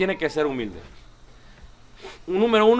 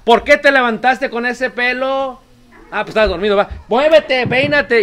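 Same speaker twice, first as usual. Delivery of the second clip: higher, louder hurried scolding; a mock exasperated parent shouting; impatient parent yelling; 5 semitones higher, 9 dB louder than usual.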